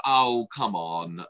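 very slow, dragged out; exasperated groan. Retold or imitated. Seeing the warden again, exasperated. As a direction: drag it out in despair.

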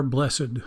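"blessed" said as BLESS-ed; two-syllable blessed. Shown as a demonstration. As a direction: sound out the -ed of "blessed" as its own syllable. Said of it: "Christians like to say".

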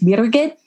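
Birgit with the r and g in the middle; Austrian name pronunciation. Shown as a demonstration. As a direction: say Birgit as BEER-gut, middle run together. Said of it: "figure out how to say it".

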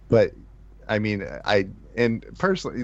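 normal talking voice

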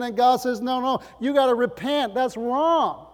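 loud, driving, high-pitched preaching to the congregation; full-force preaching. Acted. Pastor exhorting the congregation to repent, loud fervent preaching. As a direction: preach it hard, rising with every line.

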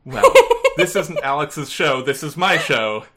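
loud, laughing defiance; defiant, laughing. Real, not acted. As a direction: declare it proudly with a laugh. Playful defiant declaration.

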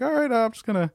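high, small voice of a scolded server; mock meek defeat. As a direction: slink away feeling sorry for yourself, hammed up. Humiliated server slinking off after scolding.

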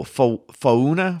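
"Fauna" drawn out as foh-OO-nuh, questioning; halting pronunciation attempt. Shown as a demonstration. Sounding out a name for co-host, halting pronunciation attempt. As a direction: stumble on fo-, then slowly try "Fauna" as foh-OO-nuh. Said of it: "Is that how you pronounce that?".

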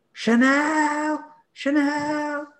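high, loud shrill call across the street; Scouse lass calling her parrot. Retold or imitated. Scouse owner calling out for her lost parrot, high yelling. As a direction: yell the parrot's name like a frantic Scouse owner. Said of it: "that Scouse lass that lost her parrot".

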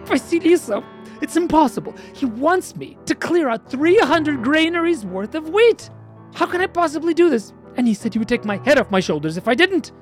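a hammed-up hero close to sobbing; crying despair. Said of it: "why are you crying".

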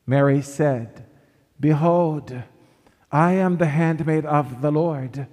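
normal talking voice

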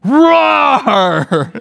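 high, long roaring shout; screamed roar. Retold or imitated. Pastor acts out his mentor's motorcycle roar, screamed. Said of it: "I'll just scream".

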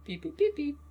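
high beeping like a phone; phone beep imitation.

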